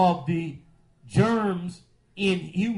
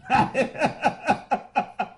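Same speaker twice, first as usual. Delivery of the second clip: high rapid cackling laugh; laughter; 5 semitones higher than usual. Real, not acted. Priest cracking up at caller's question, laughing. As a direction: burst out in a long staccato laugh.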